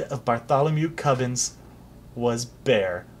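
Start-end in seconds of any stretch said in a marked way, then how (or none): none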